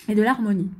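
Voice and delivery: speaking through hiccups; jolted by a hiccup. Real, not acted. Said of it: "j'ai le hoquet". (avec le hoquet)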